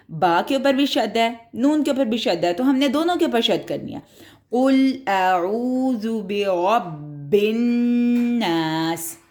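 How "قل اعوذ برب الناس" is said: slow, drawn-out tajweed recitation; slow Quranic recitation. Shown as a demonstration. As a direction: chant each word slowly, holding the shadda. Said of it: "اس کو بھی زور سے پڑھنا ہے".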